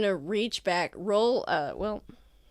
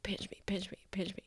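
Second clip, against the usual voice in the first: whispering; 12 dB quieter, faster than usual. Acted. Character whispers plea.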